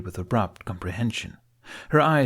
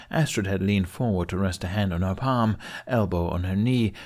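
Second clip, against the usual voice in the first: plain reading voice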